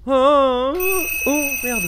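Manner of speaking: high frightened yelps; gasping scared cries; panicking gamer. Real, not acted.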